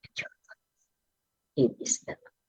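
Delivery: sudden hushed whisper. Acted. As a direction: drop to a breathy whisper for effect. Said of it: "drops to whisper after repetitions". (pabulong na pagsasalita)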